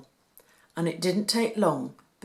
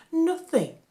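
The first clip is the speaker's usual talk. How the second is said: sighing mother; 11 semitones higher, 4 dB louder than usual. A narrator's reading. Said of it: "said Mary with a sigh".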